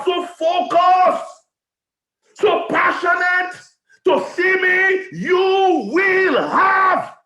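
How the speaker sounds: slow, stretched-out shouted build; preaching climax. Real, not acted. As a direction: drag out each word at full force.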